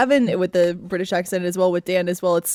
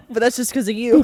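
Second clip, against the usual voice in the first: ordinary delivery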